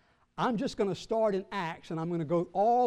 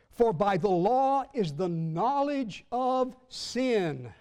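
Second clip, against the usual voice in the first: slow, higher, louder pulpit proclamation; emphatic scripture reading; 5 semitones higher, 4 dB louder, slower than usual. Acted. Preacher reading Romans on the law, slow and forceful. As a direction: ring out each phrase of the verse.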